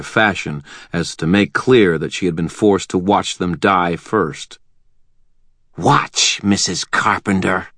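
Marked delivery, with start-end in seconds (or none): 5.8-7.7 a hint of a cruel hissing woman; hissed. A narrator's reading. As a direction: hiss it at the captive. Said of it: "Tessa hissed".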